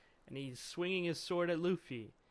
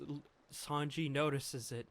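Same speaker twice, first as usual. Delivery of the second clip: usual speaking voice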